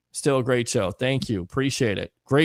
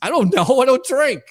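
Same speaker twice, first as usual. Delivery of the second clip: laughing; 11 semitones higher, 6 dB louder than usual. Real, not acted. Laughing reply.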